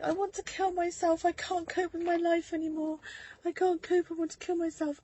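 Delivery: high, loud, tearful plea; sobbing confession, pitched high; crying despair. Retold or imitated. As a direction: voice breaking as she relives it. Repeats breakdown to the doctor.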